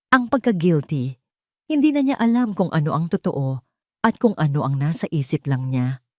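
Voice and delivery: ordinary delivery, nothing marked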